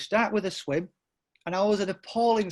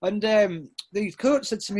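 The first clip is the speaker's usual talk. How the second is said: usual speaking voice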